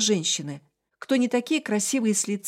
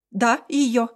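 loud firm insistence; firm and confident; 3 semitones higher, 5 dB louder than usual. A narrator's reading. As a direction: say it with full certainty. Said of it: "вложить максимум уверенности и твёрдости в голос".

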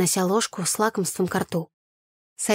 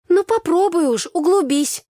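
high, loud, insistent demand; 10 semitones higher, 6 dB louder than usual. A narrator's reading. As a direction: demand to hear the whole story. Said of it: "потребовала Тася".

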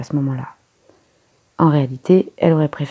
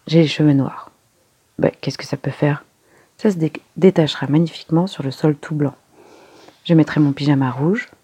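usual speaking voice

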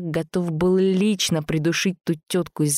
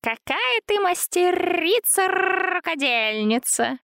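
tender cooing; slower than usual. A narrator's reading. Антон cooing at Zina.

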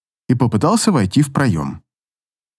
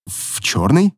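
unsure; slower than usual. A narrator's reading. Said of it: "неуверенно отозвался я".

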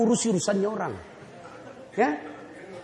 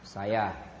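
low, gentle, unhurried voice of the Prophet; calm Prophet; 14 semitones lower, 9 dB quieter than usual. Acted. The Prophet gently answers the man who spat, low and calm. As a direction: smile through the words, easy and forgiving. Prophet at the sick man's door.

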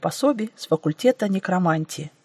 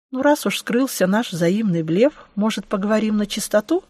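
ordinary delivery, nothing marked

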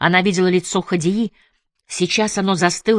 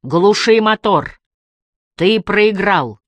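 slow, louder command; megaphone order; 3 semitones higher, 5 dB louder, much slower than usual. A narrator's reading. Emily tells Malik he has lost, slow megaphone shout. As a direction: call it out, each word drawn long. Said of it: "Снова пыталась вразумить его Эмили".

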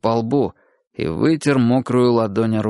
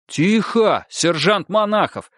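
raised, higher stern voice; 9 semitones higher, slower than usual. A narrator's reading. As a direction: raise your voice to silence him. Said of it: "тоже повысил голос лейтенант".

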